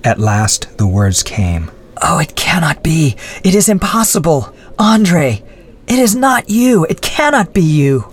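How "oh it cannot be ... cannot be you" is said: high, breaking disbelief, starting in a whisper; horrified cry. Acted. Adrienne seeing Andre caged, horrified and high. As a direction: recoil in horror. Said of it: "she cried".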